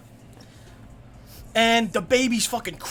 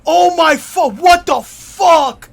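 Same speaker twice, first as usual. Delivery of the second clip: panicked yell; 6 semitones higher, 11 dB louder, much slower than usual. Acted. Panicked character quote at climax.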